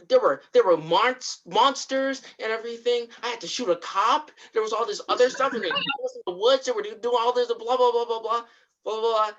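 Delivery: high-pitched, rushing mock rant; Atticus, hyped up. Atticus recounting monsters to the others, hyped up. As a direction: imitate worked-up babbling that runs away with itself. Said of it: "he's getting hyped up".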